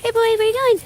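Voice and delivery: high, loud call after the dog. Real, not acted. Calling the dog running off.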